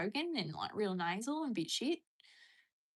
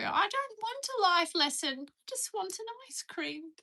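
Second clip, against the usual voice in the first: high, drawn-out whine, softening at the end; upset child quote; 12 semitones higher, 4 dB louder than usual. Acted. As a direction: plead like an upset kid quoting a cartoon. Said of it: "If I get upset about something, I go".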